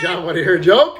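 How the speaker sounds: normal talking voice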